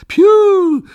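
a high, loud delighted yelp; thrilled little Moomin cry; excited cry. Acted. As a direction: squeal it out with joy. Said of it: "zawołał Muminek".

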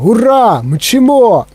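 high-pitched, exhilarated cheers; excited cheering. A narrator's reading. Grandpa Joe cheers as the lift races up, excited. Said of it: "кричав дідунь Джо".

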